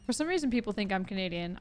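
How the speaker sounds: normal talking voice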